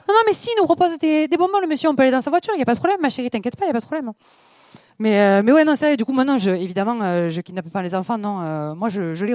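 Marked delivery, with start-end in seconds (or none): 0.1-4.1 higher, persuasive mock-reassuring voice; naive mother. Mother reassuring her daughter about the stranger, mock-cheerful and higher. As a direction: coax the child, all too trusting. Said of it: "Je vais être".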